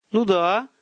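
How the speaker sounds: high-pitched, bewildered confirmation; flustered. A narrator's reading. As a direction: confirm it, thrown off balance. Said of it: "растерялся Владимир Петрович".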